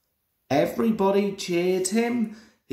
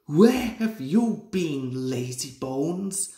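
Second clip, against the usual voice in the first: crossly; 2 semitones lower than usual. A narrator's reading. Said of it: "asked Thomas crossly".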